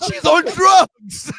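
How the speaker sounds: high, loud and slow with laughter; shocked disbelief. Retold or imitated. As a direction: stretch it out in stunned disbelief. Retelling childhood shock, laughing.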